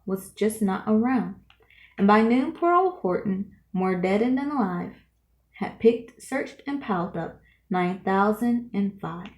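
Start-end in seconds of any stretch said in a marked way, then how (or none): none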